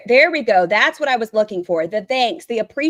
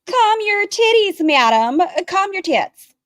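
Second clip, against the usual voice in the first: sassy retort; 11 semitones higher, 5 dB louder than usual. Acted. Snapping at streamer's daughter.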